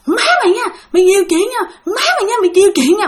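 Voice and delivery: high-pitched mock tirade; acted-out scolding in rapid repeats; retold cursing. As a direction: imitate a man swearing at you, high and insistent. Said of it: "Ổng chửi Thi vậy đó".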